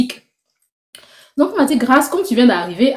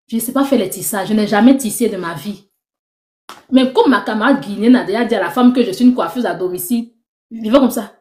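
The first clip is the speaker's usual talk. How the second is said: normal talking voice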